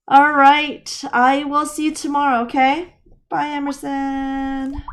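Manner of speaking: high, loud, bright sign-off; cheerful goodbye. Real, not acted.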